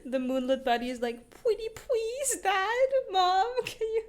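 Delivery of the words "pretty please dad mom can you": very high, drawn-out mock begging; hammed-up squeaky pleading; pleading child. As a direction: beg Mom and Dad like a little kid. Character begging parents, voiced in retelling.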